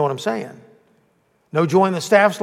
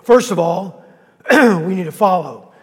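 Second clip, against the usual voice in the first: coughing through it; 3 semitones higher, 6 dB louder than usual. Real, not acted. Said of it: "Is that better?".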